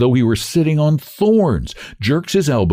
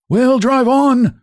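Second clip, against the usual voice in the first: much higher, louder, slow cracked order; 4 dB louder, much slower than usual. A narrator's reading. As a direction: bark it out in a cracked voice. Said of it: "in his cracked voice".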